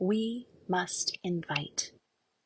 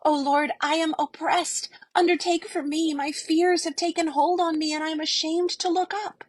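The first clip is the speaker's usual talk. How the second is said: high, loud desperate prayer; ashamed frightened plea; anguished cry for help; 10 semitones higher, 5 dB louder than usual. Acted. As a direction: cry out to the Lord in shame. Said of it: "She cried out".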